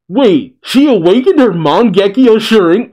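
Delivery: high, loud stunned question; shocked surprise. Real, not acted. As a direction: gasp it out in sudden shock. Shocked at manga reveal.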